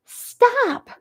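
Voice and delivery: high piglet scream. Acted. Terrified pig Wilbur screams at the sheep to stop. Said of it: "screamed Wilbur".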